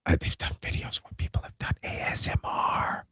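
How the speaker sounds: soft ASMR whisper. Real, not acted. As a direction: whisper it close to the mic. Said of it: "you can actually have somebody whisper".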